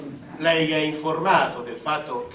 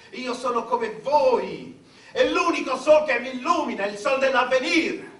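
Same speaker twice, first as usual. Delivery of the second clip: ordinary delivery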